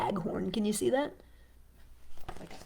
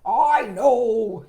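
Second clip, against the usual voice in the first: loud, drawn-out frog voice; commanding storybook frog; chief frog; 4 semitones higher, 14 dB louder than usual. Acted. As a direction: answer forcefully as the head frog. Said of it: "said the chief frog".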